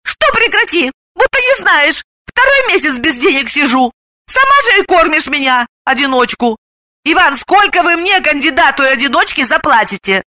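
high-pitched complaining friend; indignant, brash woman. Acted. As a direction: protest in a high voice, then haggle. Galina argues, then haggles.

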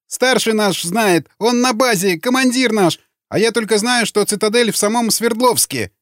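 high, whining prisoner voice; frightened confession. A narrator's reading. Prisoner at knifepoint explains, frightened. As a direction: plead and inform in a high, scared pitch.

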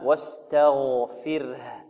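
واستغفره very slow, each letter clean; correct form. Shown as a demonstration. Teacher gives the correct form, very slow. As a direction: separate the light and heavy letters in واستغفره. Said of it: "وإنما".

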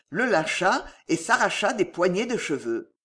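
ordinary delivery

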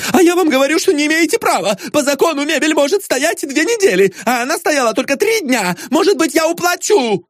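very high, squeaky indignation; fussy little man squealing his objections; excitable protester. A narrator's reading. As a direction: plead and protest in a frantic falsetto.

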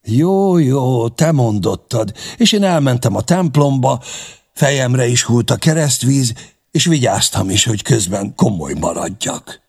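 high, loud, good-humoured yielding; animated and amused, starting high then settling; smiling concession. A narrator's reading. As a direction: give in with a smile, voice lifted. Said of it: "megint csak mosolygott".

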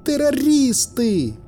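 very high, drawn-out accusing cry; woman's shout. A narrator's reading. A woman in the crowd at armed men, crying out. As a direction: cry it out high, stretching the word. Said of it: "выкрикнул чей-то голос, вроде женский".

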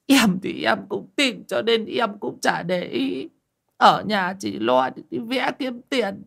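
a desperate husband begging his friend for money, near tears; on the verge of tears. Acted. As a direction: plead like a man about to break down sobbing. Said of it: "nói bằng giọng mếu máo như sắp khóc".

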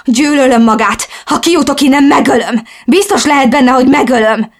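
high, loud threat full of hatred; furious yelling. A narrator's reading. Captive woman threatening to kill her captor, shouting furiously. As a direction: shout it with rising fury. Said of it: "ordítottam".

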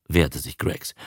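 ordinary delivery

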